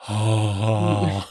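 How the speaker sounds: gasp, then hushed wonder; acted awe. Player recalling a first login to a beloved game, acted awestruck gasp. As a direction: act out an awestruck intake of breath.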